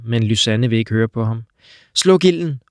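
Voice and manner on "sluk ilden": higher, louder urgent command; shouted order. Acted. Lysanne orders Tro to put out the fire, shouting. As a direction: bark the order over the fire. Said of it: "råber hun".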